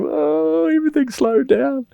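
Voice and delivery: very high, awed, stretched-out voice; stoned friend. Retold or imitated. Stoned friend in the car marvelling at slow motion, high-pitched awe. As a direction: mimic a stoned friend amazed the world slowed. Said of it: "he was sitting there going".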